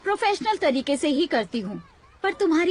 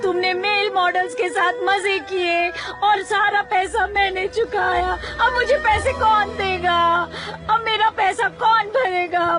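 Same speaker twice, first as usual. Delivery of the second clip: loud wailing; 2 semitones higher than usual. Acted. Boss bewailing the ruinous party bill, wailing. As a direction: bawl theatrically, stretching the ends of phrases. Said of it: "आप रोना बंद करेंगी".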